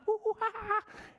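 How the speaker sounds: high hooting monkey giggle; high silly monkey laugh. Pretend.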